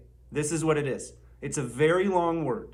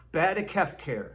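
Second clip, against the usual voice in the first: ordinary delivery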